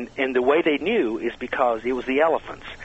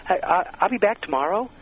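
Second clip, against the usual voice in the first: higher voice, TOMORROW rising at the end; upspeak; 6 semitones higher than usual. Acted. Guest imitates women's cultural voice, ending rising on "tomorrow". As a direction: lift "tomorrow" upward like a question. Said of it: "they go up at the, at the end of sentences".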